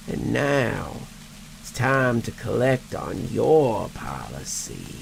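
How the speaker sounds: slow, rasping dead man; undead voice. Acted. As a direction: drag each word out like a corpse. Ezekiel's undead voice.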